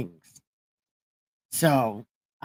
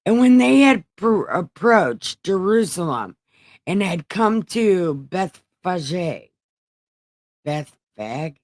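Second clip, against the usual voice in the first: higher-pitched read-aloud voice, halting at names; reading scripture aloud; 4 semitones higher than usual. Acted. Reader going on to chapter twenty-one, reading aloud. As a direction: read out the verse, feeling out the hard name.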